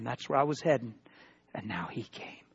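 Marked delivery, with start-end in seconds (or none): 1.5-2.4 whisper. Acted. Dramatic hushed repeat.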